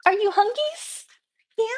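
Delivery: very high squeaky pet voice. Real, not acted. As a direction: squeal it to the puppy. Baby talk asking dog again.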